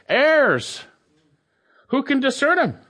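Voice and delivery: "Errors." thrown out high and loud, then "Who can discern 'em?"; emphatic word order. Shown as a demonstration. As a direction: lead with "Errors" hard and high, the rest following. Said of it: "Here we have it like this".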